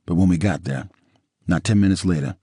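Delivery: plain reading voice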